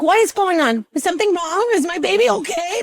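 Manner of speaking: high-pitched retold panic; freaking out. Mother asking doctor if baby is okay, freaking out. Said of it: "I was freaking out".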